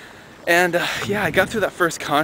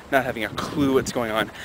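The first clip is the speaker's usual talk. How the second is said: normal talking voice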